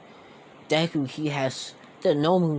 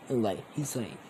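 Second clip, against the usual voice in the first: usual speaking voice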